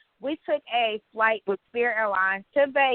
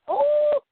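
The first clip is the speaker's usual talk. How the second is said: shriek of disgust; 7 dB louder than usual. Real, not acted. Reacting to scary Spirit flights.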